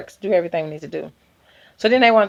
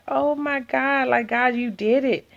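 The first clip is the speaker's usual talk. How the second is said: tearful joy; 7 semitones higher, 3 dB quieter than usual. Retold or imitated. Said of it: "I'm like".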